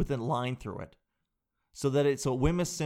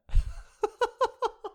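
real laughing fit; loud high laugh; 4 dB louder than usual. Real, not acted. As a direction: burst of high-pitched laughter. Big laugh at her answer.

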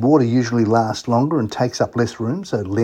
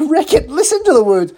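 gleeful; 13 semitones higher, 4 dB louder than usual. Real, not acted. Host excited about playing the song.

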